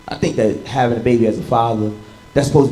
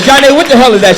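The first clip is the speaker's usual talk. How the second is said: loud, high demanding; exasperated dad; 10 semitones higher, 9 dB louder, faster than usual. Retold or imitated. Dad scolding daughter over arrows on her math. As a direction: snap at your kid over homework. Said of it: "I said".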